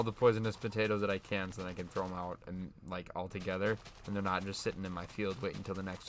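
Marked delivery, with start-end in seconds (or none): none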